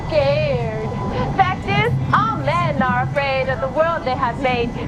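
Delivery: rhythmic, pointed slam poem reply; spoken word poetry. Acted. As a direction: fire the verse back at him.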